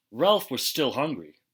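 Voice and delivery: ordinary delivery, nothing marked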